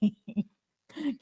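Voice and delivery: laughing while saying it; real giggle breaking into the words. Real, not acted. Laughing while hoping aloud.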